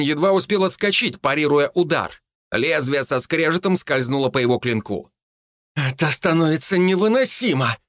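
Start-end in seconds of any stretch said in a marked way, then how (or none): 5.7-7.8 frustrated growl, pitch raised. A narrator's reading. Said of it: "прорычала она".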